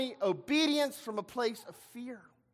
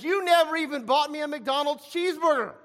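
put-on higher, aggrieved older-brother outburst; indignant complaint; 5 semitones higher, 4 dB louder than usual. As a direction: throw the grievance at the father. Older brother's complaint in modern terms.

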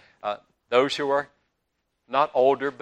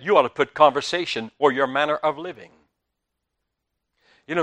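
normal talking voice

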